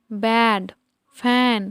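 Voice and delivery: "ব্যাড", "ফ্যান" said singly with the "ae" vowel of cat; "ব্যাড, ফ্যান" as separate model words with "ae"; short A sound. Shown as a demonstration. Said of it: "এ হিসেবে যখন এর উচ্চারণ হয়".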